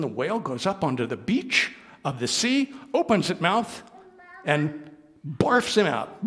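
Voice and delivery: usual speaking voice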